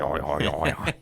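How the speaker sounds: a light mock Northern Irish accent on the yeahs; lightly ape a Northern Irish local; Northern Irish mimicry. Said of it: "These Norteños, bro, they were like".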